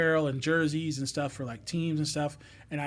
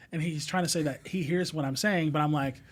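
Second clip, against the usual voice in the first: ordinary talk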